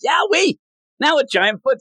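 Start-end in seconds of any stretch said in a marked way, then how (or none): 0.0-0.6 high-pitched cartoon yelp; imitated yell. Tommy yells at the giant foot, mock high yell. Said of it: "where Tommy yells".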